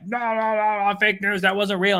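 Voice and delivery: high, mocking whine of a denier; whiny denier voice. Mocking online deniers to a co-host, in a high whiny voice. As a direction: parody the stubborn commenter in a high whine. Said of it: "be like".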